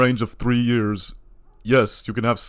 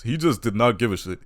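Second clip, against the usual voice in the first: normal talking voice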